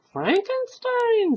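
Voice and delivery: high, slow, curious question; interested. Acted. Old man catching a familiar name, interested. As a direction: perk up at the name. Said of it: "said the old man with interest".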